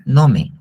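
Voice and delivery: nome said clearly as NO-me, stress on the first syllable; preacher teaching the Greek word nome to the congregation, said carefully; Greek word for pasture. Shown as a demonstration. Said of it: "pasto se dice así".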